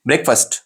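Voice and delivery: "ब्रेकफास्ट" as a quick BREK-fust; short "brek", reduced "fust" in "ब्रेकफास्ट"; correct pronunciation. Shown as a demonstration. Teacher answers what is correct, "ब्रेकफास्ट" as one short word. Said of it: "सही pronunciation क्या है?".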